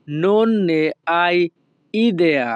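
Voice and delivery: very slow, each sound drawn out; slow demo. Shown as a demonstration. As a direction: stretch it out for the learners. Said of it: "Italian ভাষা হবে".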